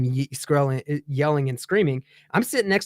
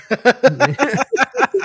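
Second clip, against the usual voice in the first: real laugh; 8 semitones higher, 5 dB louder than usual. Real, not acted. Laugh after story beat.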